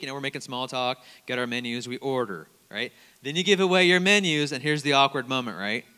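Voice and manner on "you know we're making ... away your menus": ordinary delivery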